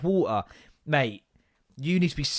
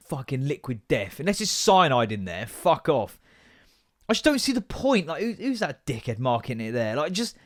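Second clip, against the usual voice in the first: ordinary talk, nothing marked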